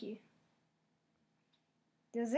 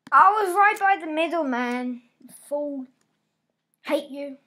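frustrated yell; 3 semitones higher, 12 dB louder than usual. Real, not acted. Frustrated yelling at game.

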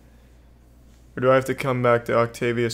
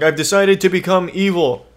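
louder, higher hammy villain rant; comical supervillain monologue; 5 semitones higher, 6 dB louder than usual. Pretend. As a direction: ham up an evil villain announcing his plan. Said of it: "a comical supervillain monologue".

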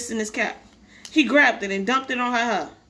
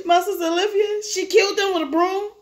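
high-pitched tearful explanation; whimpering; 7 semitones higher than usual. Acted. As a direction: whimper the accusation out to the policeman. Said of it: "He was whimpering so".

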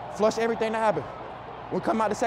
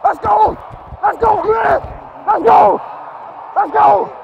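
loud high hype shout; shouting let's go; 8 semitones higher, 8 dB louder, slower than usual. Real, not acted. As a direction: yell it to fire the team up. Player hyping sideline before play.

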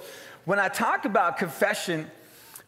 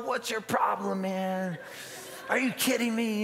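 mock indignant confrontation; 4 semitones higher, 3 dB quieter than usual. Driver confronting the man who honked, mock indignant. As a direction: challenge the other driver in mock outrage. Retelling a yelling match with another driver.

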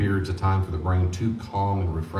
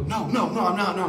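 put-on kid denying; 11 semitones higher than usual. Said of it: "are you in a bad mood".

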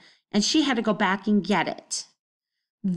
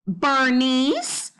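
higher, prim and disapproving; high stern neighbour; 6 semitones higher, 3 dB louder than usual. Acted. Missus Trance summoning Bernice from her patio, high prim voice. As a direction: call her over like a strict neighbour. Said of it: "she said in her high voice".

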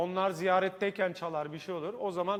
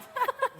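laugh. Real, not acted. Host laughs mid-announcement.

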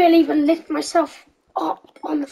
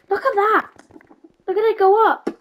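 excited; 7 semitones higher, 5 dB louder than usual. Real, not acted. Excited about game view.

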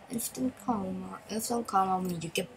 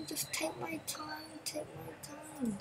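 drawn out slowly, much softer; 6 semitones higher, 9 dB quieter, slower than usual. Shown as a demonstration. Gamer telling himself to drive slowly, slow and soft. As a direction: ease it out slowly, barely above a breath.

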